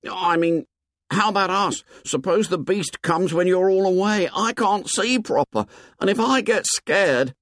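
high, anxious schoolboy voice; timid Piggy. Acted. Piggy begging the boys to stay put, high and anxious.

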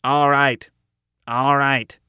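mildly booming guard over a sound system; bull voice. A narrator's reading. Guard breaking up the meeting, moderately booming. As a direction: call it out firmly in a bull voice. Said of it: "one was using a bull voice".

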